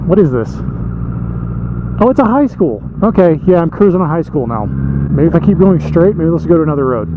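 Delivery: usual speaking voice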